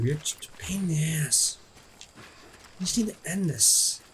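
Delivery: soft breathy aside; whispered grumble. Real, not acted. Griping at the boss under the breath, whispered. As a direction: whisper an annoyed jab. Muttered at enemy.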